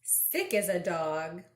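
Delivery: ordinary delivery, nothing marked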